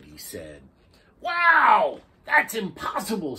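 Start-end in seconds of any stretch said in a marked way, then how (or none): 1.2-3.4 high, loud disbelief; amazed jellyfish. A narrator's reading. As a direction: squeal it in wonder. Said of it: "said the jellyfish".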